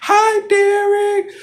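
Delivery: very high, slow, sugary mock greeting; all nice. Young female manager greeting him, mock sweet and high. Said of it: "the one that asked all nice".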